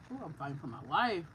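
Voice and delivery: stuffy blocked nose; congested. Real, not acted. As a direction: talk through a congested nose. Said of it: "I'm congested".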